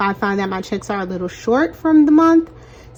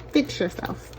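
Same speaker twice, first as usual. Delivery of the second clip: whisper, much softer, to herself; whispered aside; 10 dB quieter than usual. Real, not acted. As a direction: mutter it under your breath. Mutters to slipping item.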